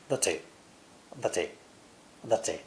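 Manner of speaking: "The T" with a crisp British T. Shown as a demonstration. Teacher showing how British English says the T. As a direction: give the T a clean British release. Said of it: "British English will usually pronounce the T differently, like this".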